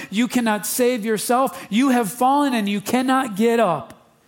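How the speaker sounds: high, loud, driving sermon voice; full-force preaching. Acted. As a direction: preach it out to the whole room.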